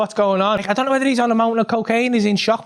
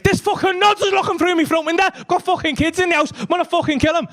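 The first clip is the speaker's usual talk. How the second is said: high-pitched irate rant; fuming; 9 semitones higher than usual. Retold or imitated. Machete man complaining to Smokey, fuming. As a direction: rant in outrage at an intruder. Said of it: "Machete's fuming. He's like".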